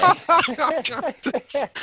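high cackle at his own joke; corny dad laugh. Real, not acted. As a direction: laugh hard at your own pun. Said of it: "I'm laughing at my own joke".

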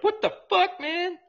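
squeaky high mock-indignant "what the fuck"; mock startled quote. Mocking a friend who was startled by Josh Blue, high and squeaky. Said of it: "You're like".

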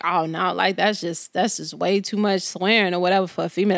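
low-pitched imitation of a disapproving man; disapproving boyfriend. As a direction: imitate a boyfriend's mild scolding. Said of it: "he was like".

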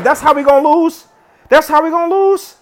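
high, outraged disbelief; incredulous. Real, not acted. Losing on a fumble.

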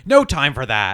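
loud mock shout; brusque woman. Woman cuts short the newcomer's moment, brusque shout. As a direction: cut him off loudly, as an impatient woman. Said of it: "the woman's like".

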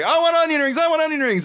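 high-pitched mock demand for food; imitated high, insistent outburst. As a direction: whine it up high like a comic drunk demanding dinner. Acting out drunk star demanding food.

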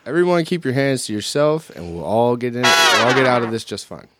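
loud, high mock warning; loud announcement. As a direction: announce it to the room.

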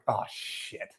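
breathy under-the-breath oh shit; hushed so the kids won't hear; whispered curse. Retold or imitated. Father hearing screams beside his kids, whispered curse.

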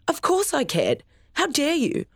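wounded and offended; outraged. Acted. Father stung by his son's accusation, outraged and high.

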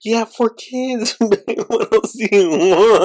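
high, slow, laughing through it. Real, not acted. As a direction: giggle through the words. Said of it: "he starts laughing a lot".

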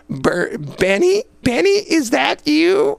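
high, slow, stretched-out squeal of his name; startled recognition. Retold or imitated. Drunk secretary in the ditch recognizes the officer, high squealing disbelief. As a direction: gasp it out like you can't believe it's him. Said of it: "And she says".

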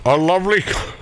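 ordinary talk, nothing marked

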